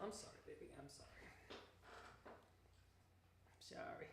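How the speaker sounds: soft, near-voiceless sorry; hushed tender apology; whispered apology to pet. Real, not acted. Apologising softly to the dog.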